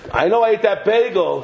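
usual speaking voice

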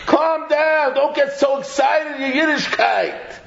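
mock chiding. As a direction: rein in an over-eager friend, hammed up. Acted complainer calming enthusiast.

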